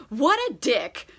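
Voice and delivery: high, fast, indignant offer to punch; mock-furious friend ready to fight; righteous anger. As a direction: play the outraged protector, half laughing. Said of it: "righteously angry on her behalf".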